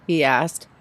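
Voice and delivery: usual speaking voice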